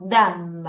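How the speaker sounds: "Them" low, tongue between teeth, humming the M to the end; th pronunciation demo. Shown as a demonstration. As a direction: model "Them" with a held M. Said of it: "갑니다". (혀를 내밀고 M을 끝까지 "Them")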